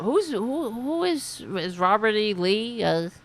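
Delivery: ordinary talk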